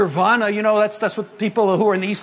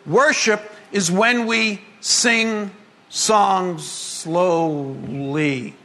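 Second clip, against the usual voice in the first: drawn-out, lower mocking of church worship; mock slow worship; 4 semitones lower, much slower than usual. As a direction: stretch it out slowly like a sleepy hymn. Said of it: "has come to the modern church to mean".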